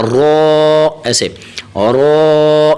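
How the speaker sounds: را made thick and full; heavy full-mouthed را; heavy raa. Shown as a demonstration. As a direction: fatten the را sound. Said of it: "تھوڑا سا موٹا کر کے".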